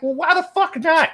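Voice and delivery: high-pitched mock incredulity; incredulous retort. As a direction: pitch shoots up in baffled protest. Acts out reply to evasive claim.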